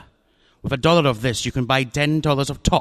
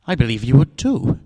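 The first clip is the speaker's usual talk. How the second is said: usual speaking voice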